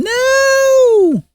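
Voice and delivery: hammed-up high-pitched shout of No; mock shriek. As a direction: scream it in mock horror. Mock shrieked refusal, loudest.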